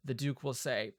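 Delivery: ordinary talk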